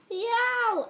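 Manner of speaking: high loud meow; cat meow. Acted. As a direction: yowl like a startled cat. Cat cries out.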